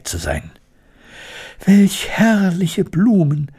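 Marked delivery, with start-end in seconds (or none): 1.6-3.5 high, drawn-out cry of delight; delighted exclamation. A narrator's reading. Elli exclaiming over the flowers, delighted. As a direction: exclaim it slowly, pitched well up. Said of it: "rief Elli".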